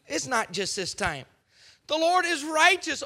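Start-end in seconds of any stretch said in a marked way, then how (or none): 1.9-3.0 mock confession. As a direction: ham up a fake sinner's plea. Mocking Pharaoh's confession.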